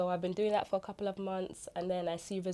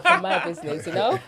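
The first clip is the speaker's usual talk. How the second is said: laughing, higher and louder; 5 semitones higher, 11 dB louder than usual. Real, not acted. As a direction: laugh through it. Laughs at the irony.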